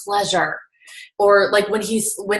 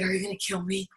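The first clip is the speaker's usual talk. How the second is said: much softer, frightened little voice; scared question; 10 dB quieter than usual. Retold or imitated. Nervous smoker asks huge Tony in the elevator, frightened. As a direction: shrink back and ask it timidly.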